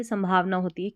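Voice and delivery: ordinary talk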